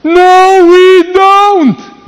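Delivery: high, full-lunged shout; stretched, blaring retort from the chest; yelling back. Retold or imitated. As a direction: holler it back as loud as the beggar. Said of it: "pot și eu să țip".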